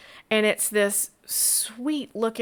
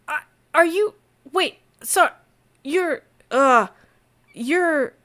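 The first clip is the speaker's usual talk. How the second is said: stunned stammer; 6 semitones higher, 5 dB louder than usual. Acted. Innkeeper stunned by the coins.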